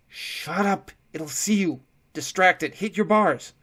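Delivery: hiss-urgent orders to distract; urgent shouts. A narrator's reading. Prisoners urge distraction in panic.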